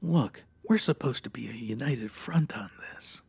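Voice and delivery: whispering to the partner mid-interrogation; whispered aside. Acted. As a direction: hiss it so the suspect won't hear.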